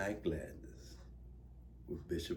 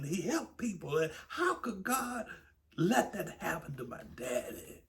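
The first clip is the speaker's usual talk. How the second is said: high, pleading preacher's lament; rising grief-stricken sermon; impassioned preaching; 11 semitones higher, 5 dB louder than usual. Acted. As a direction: cry it out to the congregation. Preacher grieving father's death.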